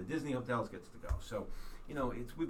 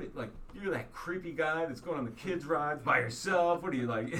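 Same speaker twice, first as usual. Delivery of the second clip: loud, higher-pitched retold disbelief; mock incredulity; 7 semitones higher, 8 dB louder than usual. Family questioning dad riding kids' rides alone, mock incredulity. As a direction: imitate an appalled family member calling him out.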